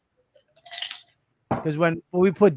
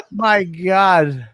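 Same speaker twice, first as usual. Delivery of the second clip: ordinary talk, nothing marked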